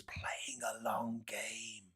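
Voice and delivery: sneering hushed aside, almost breathless; mocking whisper. Real, not acted. Commentator sneering at the opposition leadership, whispered. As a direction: whisper it with contempt.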